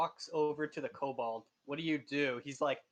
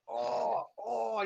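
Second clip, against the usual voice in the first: 1 kobold coughing; 7 semitones higher, 3 dB louder than usual. Acted. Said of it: "He's like".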